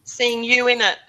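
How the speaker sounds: usual speaking voice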